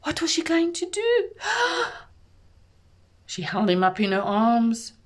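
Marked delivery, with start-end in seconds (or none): 1.3-2.0 high startled gasp; suspenseful gasp. A narrator's reading. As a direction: gasp at the danger. Storyteller builds suspense.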